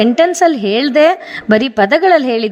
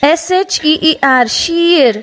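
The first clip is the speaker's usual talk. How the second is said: measured dictation pace for learners; unhurried classroom spelling read-out; slow spelling drill. Shown as a demonstration. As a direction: spell each letter slowly, then the word. Slow spelling drill of ear words.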